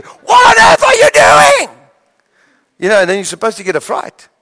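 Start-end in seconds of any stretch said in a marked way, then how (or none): 0.0-1.8 very high, slow, loud scolding yell; imitated parental bellow; parent's shout. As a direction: shout at a child to startle them. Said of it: "they shout at you".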